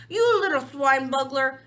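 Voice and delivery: loud, enraged insult; furious roar. Acted. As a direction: roar it at him in fury. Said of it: "roared the blood bottler".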